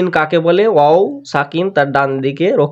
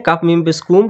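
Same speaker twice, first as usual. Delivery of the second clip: ordinary talk, nothing marked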